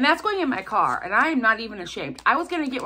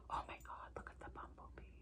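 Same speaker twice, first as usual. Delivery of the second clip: hushed, voiceless whisper; barely audible whispered delight; awed whisper; 24 dB quieter, faster than usual. Real, not acted. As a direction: whisper it in real amazement. Awed by the bumblebee gift.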